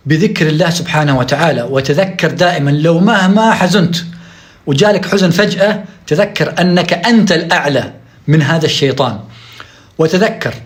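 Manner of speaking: ordinary talk, nothing marked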